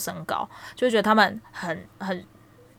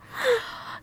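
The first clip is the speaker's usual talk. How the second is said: laughing; 8 dB quieter than usual. Real, not acted. Laughs at being grabbed.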